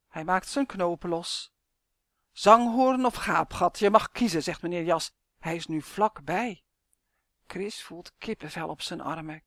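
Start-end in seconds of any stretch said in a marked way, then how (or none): 2.3-4.4 louder, ominous voice; menacing coat. Acted. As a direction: lay out the choice as a threat. Coat menacingly offers a choice. (dreigende, luide jas)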